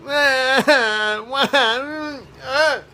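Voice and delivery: high pleading mock whines; whining for an object out of reach; whiny whimpers. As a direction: whine like a toddler wanting something. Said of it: "I'm like".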